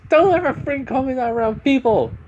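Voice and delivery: high, loud acted yell; angry outburst. Stepsister angry at Bond's teasing, high angry yell. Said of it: "she just gets a little bit angry".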